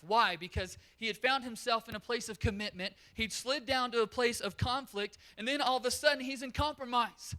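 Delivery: rising revival sermon voice; fired-up preaching. Acted. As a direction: preach it out, raising the energy. Preacher at full force.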